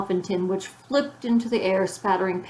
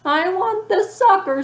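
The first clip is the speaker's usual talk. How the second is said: much higher, louder slow wailing scream; 12 semitones higher, 7 dB louder, slower than usual. A narrator's reading. Ramona howling for Wayne's sucker, scream. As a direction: scream it in full tantrum. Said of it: "screamed Ramona".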